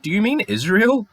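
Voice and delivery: high, pointed "Do you mean Israel?" with stress on mean; retelling a disbelieving challenge; high mock-innocent probing; incredulous question. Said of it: "And I was like".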